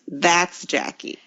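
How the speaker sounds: ordinary delivery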